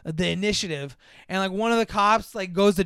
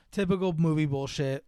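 ordinary delivery

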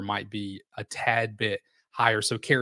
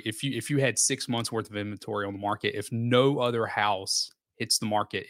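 ordinary talk

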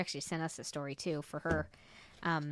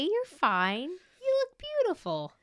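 mock reassuring; 12 semitones higher, 5 dB louder, slower than usual.

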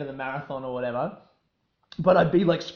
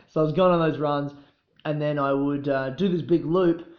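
ordinary talk, nothing marked

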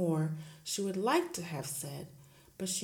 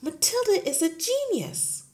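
higher, earnest insistence; eager claim; 14 semitones higher, 9 dB louder than usual. Acted. Teacher pleading for her gifted pupil, higher. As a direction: insist she is special. Miss Honey voiced in story.

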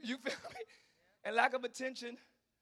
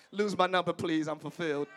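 lower, laughing brush-off; dismissive; 6 semitones lower, 5 dB louder than usual. Pretend. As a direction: wave them off, unbothered. Brushing off a club flirt.

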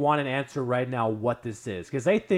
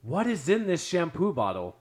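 retold uncle demanding; 6 semitones higher, 3 dB louder than usual. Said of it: "hear my uncle go".

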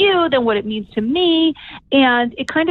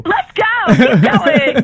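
high, loud cheering on; rally her to keep going; excited cheer; 4 semitones higher, 5 dB louder, much slower than usual. Real, not acted. Host cheering on her guest, excited high call.

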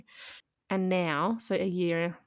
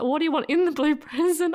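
louder, higher, breaking into laughter; laughing question; 8 semitones higher, 7 dB louder than usual. Retold or imitated. Mum asking her son about the present, laughing. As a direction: try to ask but laugh through it. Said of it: "I was like".

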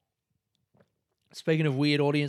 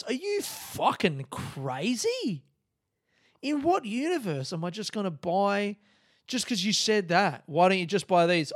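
worked-up, higher-pitched sarcastic rant; indignant, scoffing outburst; still angry; 5 semitones higher, 3 dB louder than usual. Real, not acted. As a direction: rant with exasperation, pitch raised. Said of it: "I'm still angry".

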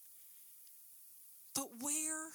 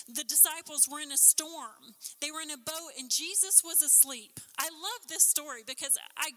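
normal talking voice

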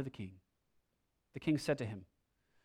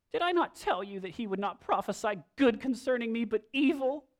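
aggrieved king; 7 semitones higher than usual. Retold or imitated. King complains to Jehoshaphat.

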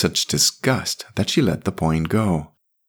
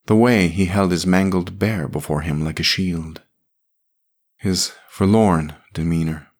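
ordinary delivery, nothing marked

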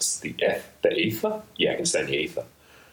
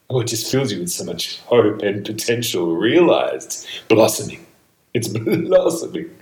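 high, loud rapture with a laugh; ecstatic; 5 semitones higher, 7 dB louder than usual. Real, not acted. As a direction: burst out with joy. Ecstatic about blossoming.

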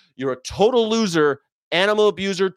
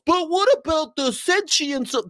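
mock objector; 12 semitones higher than usual. Mocking a nitpicking objection.

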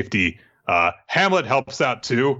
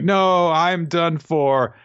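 mock melodrama; 7 semitones higher, much slower than usual. Kiku voiced melodramatically.

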